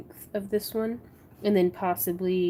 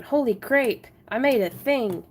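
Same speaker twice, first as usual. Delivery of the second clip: excited exclaim; 4 semitones higher, 6 dB louder than usual. Real, not acted. Excited about finished piece.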